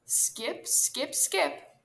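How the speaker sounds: lightly projected, rhythmic call; calling back. A narrator's reading. Squirrel scampering ahead of turtle, calling back. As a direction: call it out in separate little beats. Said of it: "calling behind him".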